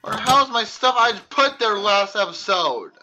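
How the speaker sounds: ordinary talk, nothing marked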